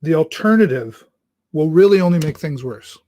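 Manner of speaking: normal talking voice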